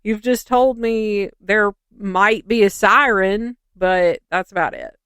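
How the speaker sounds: loud, incredulous complaint; baffled and fed up, half shouting; exasperated. Retold or imitated. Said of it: "I was like".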